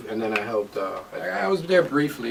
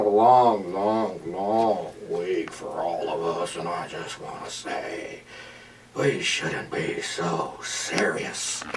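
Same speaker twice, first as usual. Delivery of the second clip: slow, low Joker impression; 8 semitones lower, 5 dB quieter, much slower than usual. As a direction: draw it out like the Joker. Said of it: "that was a good Joker".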